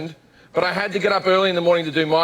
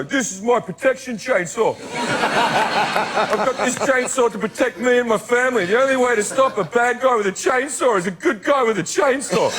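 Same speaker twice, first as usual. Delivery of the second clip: high, hammed-up righteous defender; mock gun owner; 5 semitones higher than usual. Imagined man in Target justifying his chainsaw, mock righteous. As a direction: defend it high and self-important, as a joke.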